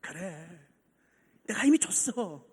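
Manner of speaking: soft, low reassurance; hushed, tender acted reply; gentle God. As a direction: answer quietly, as a tender father. God's gentle reassurance to believer.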